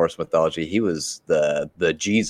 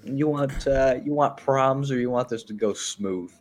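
imitating Odin; 8 semitones higher than usual. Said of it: "he's just like".

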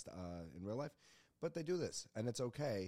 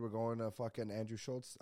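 ordinary talk

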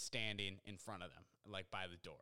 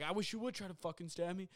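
raised-pitch mock challenge; retold dare; 7 semitones higher than usual. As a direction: act out goading an opponent. Worker repeats his dare.

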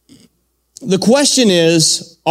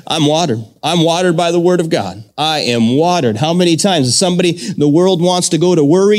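ordinary talk, nothing marked

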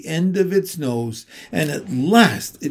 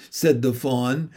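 usual speaking voice

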